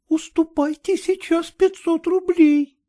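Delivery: high, playful scolding; coquettish young woman. A narrator's reading. Agniya coaxing Kutsin, high and playful. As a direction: tease him into giving way.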